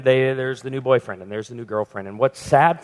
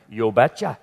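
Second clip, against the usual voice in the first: lower, thick Norwegian "You betcha"; Norwegian accent; 5 semitones lower than usual. Retold or imitated. Pastor imitating Norwegian father saying yes in Norwegian accent. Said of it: "in his thick Norwegian accent".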